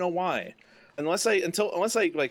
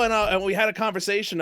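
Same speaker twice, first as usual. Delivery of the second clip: ordinary talk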